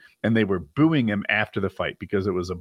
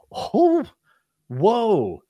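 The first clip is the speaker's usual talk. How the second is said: high, drawn-out whoa of amazement; a big stunned oh, whoa; 10 semitones higher than usual. Retold or imitated. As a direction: gasp it out in awe. Stunned reaction to trash talk.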